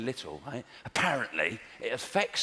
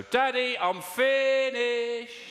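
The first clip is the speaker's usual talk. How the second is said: put-on high, slow little kid calling from the house; little child's voice; 10 semitones higher, 3 dB louder, much slower than usual. Child calling dad from the toilet, high and drawn out. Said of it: "I heard a little voice go".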